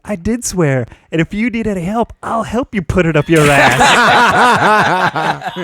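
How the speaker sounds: mock defiance breaking into a laugh; laughing retold insult. Applicant mocks boss, laughing as he speaks. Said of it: "I was like".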